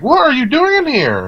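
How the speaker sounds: high, sharp teacher's challenge. Retold or imitated. Teacher bursting into the library, high and demanding. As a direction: catch him red-handed, way up high. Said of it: "and then she's like".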